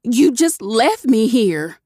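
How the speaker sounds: slow, pointed accusation; accusing hiss. A narrator's reading. Cherry accuses Dante of leaving her, slow and pointed. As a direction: make him feel it. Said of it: "came out in a hiss".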